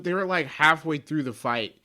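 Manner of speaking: usual speaking voice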